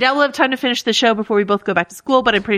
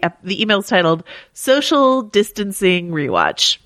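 normal talking voice

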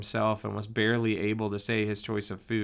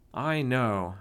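plain reading voice

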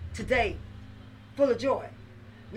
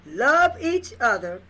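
slow, louder, every word spaced out; said slowly; 3 semitones higher, 8 dB louder, slower than usual. Shown as a demonstration. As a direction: lay Love each other down slowly, word by word. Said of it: "I'm gonna say it slowly".

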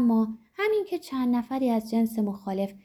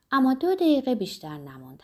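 plain reading voice

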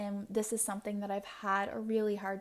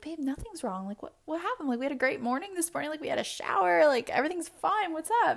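higher, building to louder insistence; imitated boyfriend reassuring; 7 semitones higher, 4 dB louder, faster than usual. Boyfriend insists to girlfriend that nothing's wrong, rising louder. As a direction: reassure her, getting more insistent.

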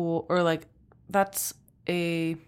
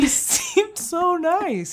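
mock innocent; 12 semitones higher, 3 dB louder, much slower than usual. Mock-innocent remark about a killer.

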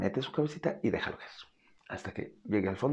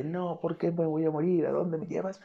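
panicked cat; 7 semitones higher, 4 dB louder than usual. Retold or imitated. Voicing the cat's panicked howl.

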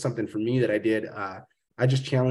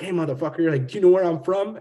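higher, louder confrontational threat; mock tough-guy challenge; 6 semitones higher, 4 dB louder than usual. Marine buddy ready to throw blows, aggressive challenge. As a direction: square up like a hothead Marine.

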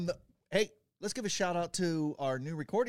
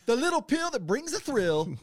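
high, louder hammed-up slogan; mock ad slogan; 9 semitones higher, 6 dB louder than usual. Answering the mock ad with a loud cheesy slogan. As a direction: pitch it like a cheesy commercial.

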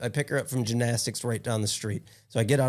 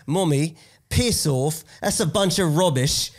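higher-pitched mimicked British accent; 4 semitones higher, 4 dB louder, slower than usual. Father imitating his daughter insulting her mother, British accent. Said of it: "she talks in a British accent".

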